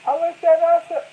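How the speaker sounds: high, loud, worked-up telling; rising excitement as he tells it; storyteller working himself up, high and loud; excited. Real, not acted. Building to punchline.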